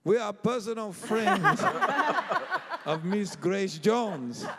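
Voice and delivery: loud, high, grandly drawn-out announcement; imitating the star's affectation. Young musicians shouting over a clamoring crowd, affected imitation. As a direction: call it out in hammed-up diva tones. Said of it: "there's a whole crowd clamoring".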